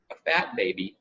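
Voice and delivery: ordinary delivery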